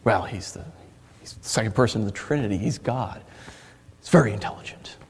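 soft, half-whispered words; hushed aside. Real, not acted. A preacher musing to the congregation, hushed. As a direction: drop to a near whisper.

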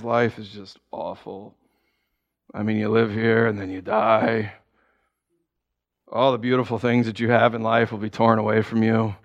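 low, gloomy mock monotone; Debbie Downer. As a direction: imitate a hopelessly depressing speaker. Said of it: "they're just Debbie Downers".

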